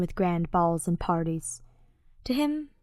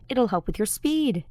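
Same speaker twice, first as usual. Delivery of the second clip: mocking quote; 4 semitones higher than usual. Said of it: "they said".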